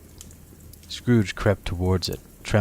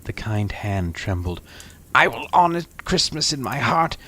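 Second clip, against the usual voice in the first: high, loud, urgent pleading; fervent plea; 9 semitones higher, 5 dB louder than usual. Acted. Scrooge falls before the spirit and begs, high and loud. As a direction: beg with all your heart.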